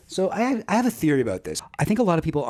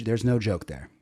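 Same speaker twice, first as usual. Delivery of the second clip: normal talking voice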